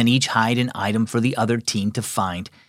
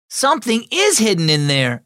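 excited, higher-pitched exclamation; exclaiming; 8 semitones higher than usual. A narrator's reading. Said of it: "exclaimed Miles".

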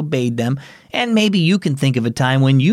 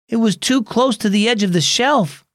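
put-on higher boy voice, defensive; boy making excuses; 8 semitones higher than usual. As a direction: shift the blame like a kid. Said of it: "Danny said".

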